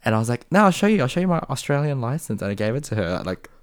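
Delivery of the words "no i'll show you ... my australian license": much higher, louder retold insistence; insistent protest. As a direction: insist urgently, pitched high to convince a doubter. Retold insisting on proof to doubter.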